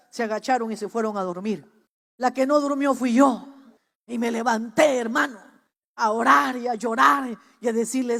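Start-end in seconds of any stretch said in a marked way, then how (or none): none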